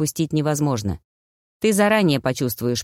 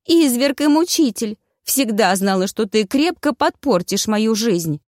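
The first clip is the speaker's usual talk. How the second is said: high-pitched theatrical accusation, easing toward the end; wailing lament; 5 semitones higher than usual. A narrator's reading. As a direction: bewail your tormentor. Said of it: "запричитала Таня".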